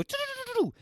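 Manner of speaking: very high playful do-do-do; teasing the dog with a squeaky sing-song; squeaky squish noise. Shown as a demonstration. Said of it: "I go".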